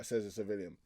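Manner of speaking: ordinary delivery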